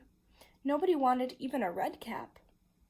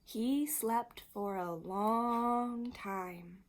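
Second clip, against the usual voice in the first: very slow, stretched storytelling; slow, lingering narration; drawn out; much slower than usual. A narrator's reading. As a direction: stretch each word out like a long sleep. Long sleep drawn out in story.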